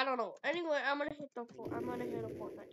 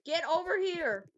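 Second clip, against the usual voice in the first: higher, louder call; shouted call; 7 semitones higher, 6 dB louder than usual. Real, not acted. Troll chasing a player, higher and louder shout. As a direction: yell after someone getting away.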